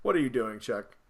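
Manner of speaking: low, soft, baffled deadpan. Retold or imitated. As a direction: mutter it in disbelief. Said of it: "I'm like".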